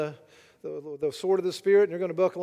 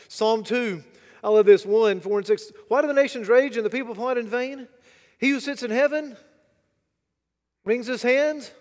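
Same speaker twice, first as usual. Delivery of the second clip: preaching at full force; 5 semitones higher, 9 dB louder than usual. Acted. Preacher to congregation, full-force preaching. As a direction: hammer the point home to the congregation. Reading the psalm aloud.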